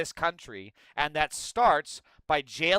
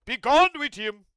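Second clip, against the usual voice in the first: high, loud Boss Nass boom; 8 semitones higher, 8 dB louder than usual. Retold or imitated. Streamer imitating Boss Nass to mock Tom Oman, loud. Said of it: "that's who he sounds".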